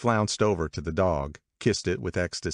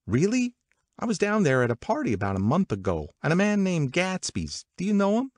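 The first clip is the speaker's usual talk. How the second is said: high, lively gossiping woman; gossipy Catherine; 13 semitones higher than usual. Acted. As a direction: pitch it up like eager party gossip. Voices Catherine's party gossip.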